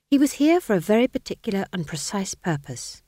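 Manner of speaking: ordinary delivery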